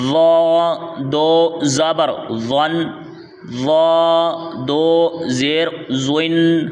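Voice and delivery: slow drill with thick emphatic ظ in ظا; full, heavy letter. Shown as a demonstration. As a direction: draw out ظا as a rounded "zwaa", then ظن as "zwan". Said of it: "پر یعنی موٹا پڑھنا ہے".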